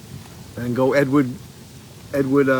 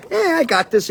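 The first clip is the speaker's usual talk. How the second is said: much higher bratty brag; cocky kid; 12 semitones higher than usual. Retold or imitated. Quoting the TV-obsessed boy rushing to get shrunk, mock cocky. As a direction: toss it off like a know-it-all kid. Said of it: "and he's like".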